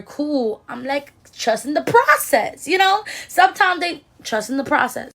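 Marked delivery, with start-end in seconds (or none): none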